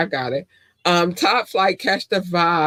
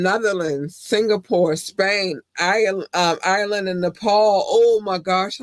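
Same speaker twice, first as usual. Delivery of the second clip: ordinary delivery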